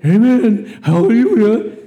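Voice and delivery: high, loud mock praise shout; mocking amen. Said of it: "They're going".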